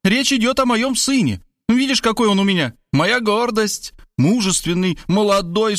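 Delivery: high-pitched boastful mother; exultant, high listing of virtues; triumphant. A narrator's reading. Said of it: "торжествуя гаркнула мать".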